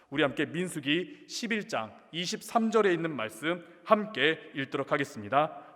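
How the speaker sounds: usual speaking voice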